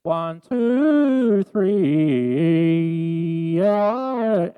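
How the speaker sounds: auto pitch effect. Shown as a demonstration. Streamer testing auto pitch on the live stream, loud and robotic. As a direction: count off through a harsh pitch shifter. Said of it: "My auto pitch sounds like this".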